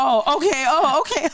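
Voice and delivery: high, laughing dawning understanding; sudden realization. Retold or imitated. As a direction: catch the hint with amused surprise. Acts out catching receptionist's hint.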